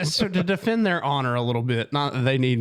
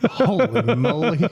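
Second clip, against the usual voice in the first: amazed; 4 semitones higher, 3 dB louder, slower than usual. Real, not acted. Amazed at a photo of hair.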